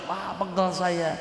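normal talking voice

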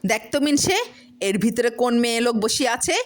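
jealous wife confronting husband; angry accusation. Acted. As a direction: shove the proof in his face.

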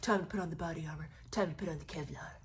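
low, soft mock-tough soldier voice; mocking self-important soldier. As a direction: parrot his little words in a low, puffed-up murmur. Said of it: "Look at him using his little words".

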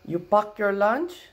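put-on high, slow, loud Filipino grandma accent; imitating Filipino grandma. Grandma asking grandson about lunch in a Filipino accent. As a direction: mimic grandma's broken English in a Filipino accent. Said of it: "And my grandma goes".

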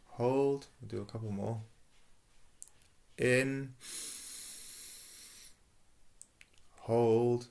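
slow meditation cues with long pauses between; sustained, drawn-out single words, softening on the out-breath; guided breathing. Real, not acted. Leads a breathing exercise.

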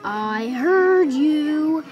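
slow, loud big bad carrot; villain yell. A narrator's reading. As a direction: stretch it out as a menacing mock yell. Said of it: "yelled the big bad carrot".

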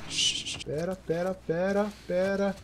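very soft, stealthy hush. Real, not acted. Gamer telling himself to wait, hushed. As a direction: keep it tiny, as if sneaking up. Sneaking up on enemies.

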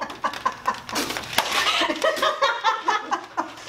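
laughing. Real, not acted. Pie just hit the face.

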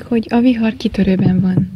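plain reading voice

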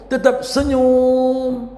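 SENYUM held long on a high note; drawn-out emphasis. Shown as a demonstration. Models keeping a smile. (kata SENYUM dipanjangkan dengan nada tinggi)

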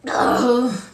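loud sudden sneeze. Real, not acted. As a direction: sneeze loudly. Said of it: "my sneezes are so loud".

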